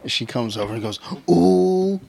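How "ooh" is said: high, loud, drawn-out ooh; scandalized schoolkid imitation; tattling child. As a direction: mimic a kid telling on someone. Child tattling on another, retold.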